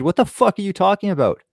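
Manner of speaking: high-pitched incredulous outburst, retold; retold exasperated challenge; exasperated disbelief. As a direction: replay your baffled, annoyed comeback. Retold argument, son exasperated.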